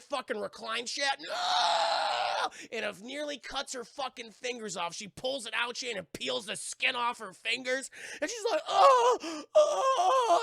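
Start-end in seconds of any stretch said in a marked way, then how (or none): 1.2-2.5 high, drawn-out scream; long cartoon "no" scream; mock scream. Acts out the reclining chair accident. 8.7-10.4 high, loud screech of pain; hammed-up pained wail; mock screeching. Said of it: "she's screeching and everything".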